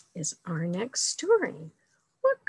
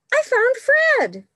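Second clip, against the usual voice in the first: much higher, loud excited cry; cried out; 9 dB louder than usual. Acted. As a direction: cry out the happy news. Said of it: "cried Sam".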